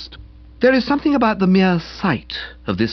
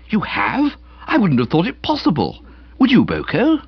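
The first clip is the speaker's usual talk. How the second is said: high, incredulous questioning; amazed; 7 semitones higher than usual. A narrator's reading. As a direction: marvel aloud at the news. Friends amazed at Jeeves's success.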